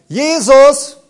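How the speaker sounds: high, loud shout of Jesus; rousing shouted Jesus. Retold or imitated. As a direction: hurl the name out loud against the threat.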